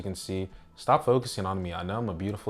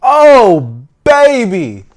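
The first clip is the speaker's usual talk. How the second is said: excited; 11 dB louder, much slower than usual. Real, not acted. Opening the box, overjoyed.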